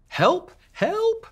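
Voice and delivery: shrill cry of a stuck carrot; cried for help. Acted. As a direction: squeal for help like a tiny scared carrot. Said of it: "cried Carrot".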